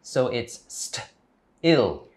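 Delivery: "st-, ill." broken into two parts; split syllables. Shown as a demonstration. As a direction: say st- then ill slowly. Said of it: "Still is spelled S T I L L".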